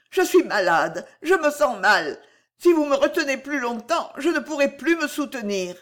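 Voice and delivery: a faint, distressed lady begging release; Cécile's voice, unwell. Acted.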